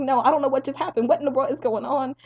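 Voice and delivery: high, loud mock bewilderment; frantic confusion. Confused patient answering his family, frantic. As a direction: answer in exaggerated helpless alarm.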